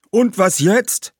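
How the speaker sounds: high, loud, slow puzzled question; ghosts asking. A narrator's reading. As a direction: ask as the baffled ghosts. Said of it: "fragten die Geister". (hoch, laut und langsam fragende Geister)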